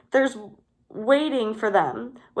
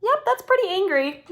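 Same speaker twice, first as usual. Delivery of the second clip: quick high approval; 10 semitones higher, faster than usual. A narrator's reading. Cassie judging fox.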